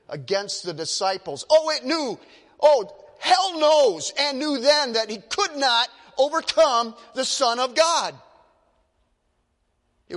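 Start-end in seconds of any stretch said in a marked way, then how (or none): 1.5-8.1 loud, high preaching climax; full-force preaching. Real, not acted. Preacher thundering to the congregation, loud and high.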